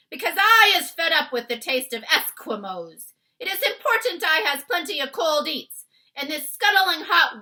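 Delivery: loud, high bullying giant voice; taunting giant. Acted. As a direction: gloat like a brute. Giant explaining his hunger.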